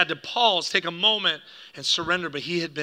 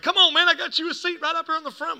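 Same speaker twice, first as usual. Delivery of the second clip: high, loud, pushy welcome; hammed-up eager usher calling someone over; overzealous greeter; 11 semitones higher, 5 dB louder than usual. Church greeter urging dad to a front seat, overzealous. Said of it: "the overzealous, uh, guy in the front".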